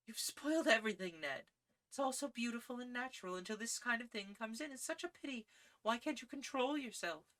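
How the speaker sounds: a lady scolding her suitor, pained; Gladys reproachful. A narrator's reading.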